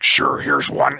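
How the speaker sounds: very low monster voice; Venom. Acted. Monster handing over a symbiote, very low voice. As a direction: drop deep, like an obliging monster. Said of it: "And then Venom is like".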